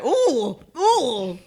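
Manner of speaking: high, loud imitating ooh; impressed ooh. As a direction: copy his wowed reaction. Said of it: "this is what your eyes did".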